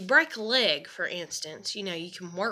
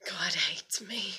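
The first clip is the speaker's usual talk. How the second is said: slow unvoiced whisper of despair; whispered self-pity; slower than usual. To her boyfriend, a slow defeated whisper.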